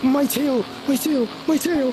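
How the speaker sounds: high, loud pained yelps; panicked squeal. Pretend. Character cries out in pain.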